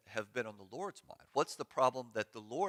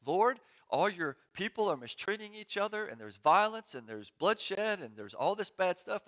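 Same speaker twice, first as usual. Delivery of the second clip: higher, urgent complaint, bloodshed slowed; pleading; 9 semitones higher, 5 dB louder than usual. Retold or imitated. Habakkuk crying out to the Lord, higher urgent plea. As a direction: plead with rising alarm. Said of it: "Habakkuk's like".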